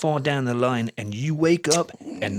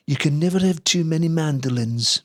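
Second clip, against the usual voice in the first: put-on Liverpool accent, raised in pitch; 4 semitones higher, 3 dB louder than usual. Quoting a Beatle on mandolins, Liverpool accent. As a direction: quote it in a lilting Scouse voice. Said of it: "once said in his Liverpool accent".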